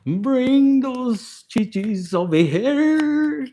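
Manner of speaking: very slow, stretched-out lecherous call; Papi Infinite. Acted. Papi Infinite summoning a lover, slow drawn-out voice. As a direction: draw every word out like a lusty summons. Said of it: "This is fucking Papi Infinite".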